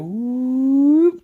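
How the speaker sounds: drawn-out, high-pitched "whoop". Real, not acted. Going back from the Jikdo for viewers, high whoop. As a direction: make a long whoop far above speaking pitch. Swoop sound while switching swords.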